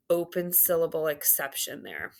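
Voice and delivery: normal talking voice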